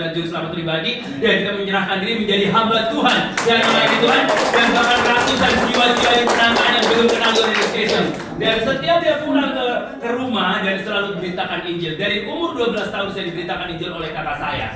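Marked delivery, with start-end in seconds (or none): none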